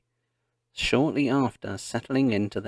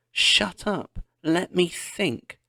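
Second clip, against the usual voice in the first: high sharp screech, then slower; 8 semitones higher, slower than usual. A narrator's reading. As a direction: snap back in panic. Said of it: "Laura screeched".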